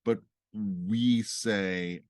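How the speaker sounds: ordinary delivery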